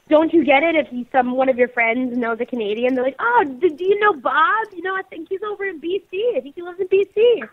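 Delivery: normal talking voice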